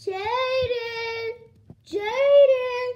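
loud, high, drawn-out name call; sing-out shout of a name. Acted. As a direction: call someone from another room. Mother calls out to child.

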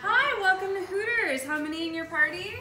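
high, loud, perky restaurant hostess; put-on hostess greeting. Mom playing Hooters hostess to her family, high and loud. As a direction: greet guests in an exaggerated high-pitched server voice.

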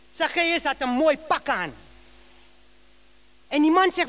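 normal talking voice

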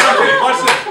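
loud and high through laughter; barely gets it out while laughing. Real, not acted. Still laughing at story.

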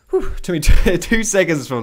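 loud, high, laughing out of a puff of breath; laughing triumph. Real, not acted. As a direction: crow over a quick win through a laugh. Crowing breathlessly after a quick kill.

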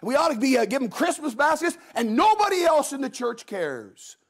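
high, aggrieved church member; self-pitying complainer. Retold or imitated. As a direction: complain in a high voice that nobody cares. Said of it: "somebody with mercy says".

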